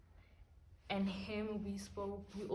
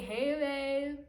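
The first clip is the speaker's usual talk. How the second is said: loud, higher, drawn-out lovey-dovey greeting; gushing greeting; 5 semitones higher, 8 dB louder than usual. Retold or imitated. Re-enacting picking up the boyfriend's call, gushing greeting. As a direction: belt out an exaggerated pet-name hello.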